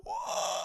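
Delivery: long, drawn-out mock monster howl; monstrous wail. Monstrous version of Henrietta at the family, monstrous wail. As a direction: wail like a body snatcher. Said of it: "this monstrous version of Henrietta go".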